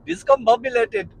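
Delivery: the single word in a high playful Indian accent. Shown as a demonstration. Showing listeners the word in an Indian accent. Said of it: "And if you say discombobulated".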